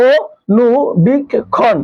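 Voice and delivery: অনুবীক্ষণ stretched out very slowly, syllable by syllable; teacher slowly sounding out অনুবীক্ষণ; slowly drawn out. Shown as a demonstration. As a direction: drag out অনুবীক্ষণ slowly for the class to hear. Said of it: "একটু ভাঙার চেষ্টা করি".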